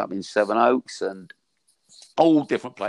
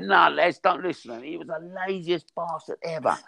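higher, teasing voice to the lads; old mate ribbing; 8 semitones higher than usual. Retold or imitated. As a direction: warn the lads off him, pitched up. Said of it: "He said".

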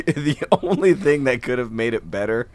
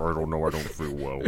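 very low, soft sick mumble; under-the-weather voice; 8 semitones lower, 10 dB quieter, faster than usual. Retold or imitated. Explaining himself to buddy's wife, low sick voice. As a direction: sink low, as if unwell. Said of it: "my voice was just like".

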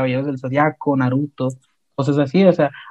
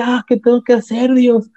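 despairing plea; 7 semitones higher than usual. Retold or imitated. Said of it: "Y dice".

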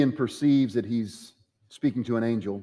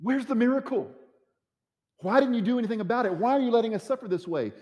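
anguished why; 10 semitones higher than usual. Acted. Preacher voicing people's complaint to God.

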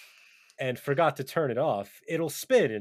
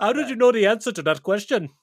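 put-on high, loud Indian quiz-host accent; mock Indian accent; 8 semitones higher, 6 dB louder than usual. Questioning the Slumdog contestant, mock Indian accent. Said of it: "watching a Slumdog here".

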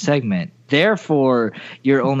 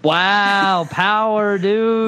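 mock outrage; 6 semitones higher, much slower than usual. Real, not acted. Mock outburst at friend's game slip.

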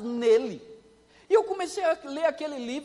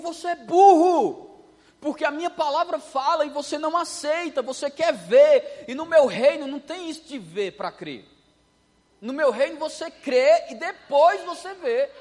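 loud, slow, booming divine voice; stern rebuke; 4 semitones higher, 9 dB louder, slower than usual. Retold or imitated. God scolds the doubting cane cutter, loud and stern. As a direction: thunder the lesson down at him. Preacher voicing God's rebuke.

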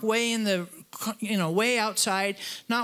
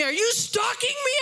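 much higher mock accusation; accusing question; 11 semitones higher than usual. Preacher imitating the angry driver in the restaurant, mock accusing. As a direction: toss back the driver's suspicious challenge. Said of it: "he says to me".

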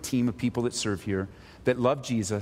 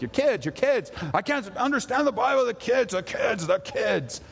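a hammed-up whiny churchgoer, higher; mock complainer; 8 semitones higher than usual. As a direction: parody the grumbling parent.

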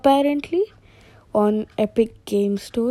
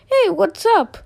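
higher cartoon chicken greeting; 10 semitones higher than usual. One chicken greeting another, higher cartoon voice. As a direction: chirp a casual hello as a chicken.

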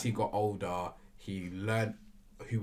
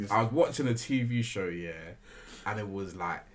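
ordinary talk

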